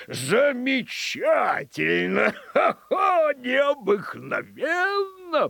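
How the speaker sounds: high, drawn-out, laughing exclamations; delighted governor. Acted. Governor applauds the dog's trick, delighted marvelling. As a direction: stretch each word in gleeful amazement.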